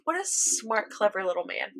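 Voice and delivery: hushed, impressed aside; quiet admiration. Retold or imitated. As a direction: drop the volume and admire him. Retold admiring reaction to Greed.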